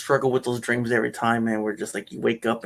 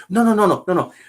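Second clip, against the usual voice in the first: excited protest; 5 semitones higher, 8 dB louder, faster than usual. Real, not acted. Laughing protest, loud and high.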